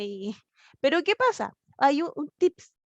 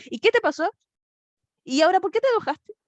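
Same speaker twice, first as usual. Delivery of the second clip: higher and louder scolding questions; annoyed parent; 7 semitones higher, 5 dB louder than usual. Retold or imitated. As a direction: snap at the child in mock irritation. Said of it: "Otros nos dicen".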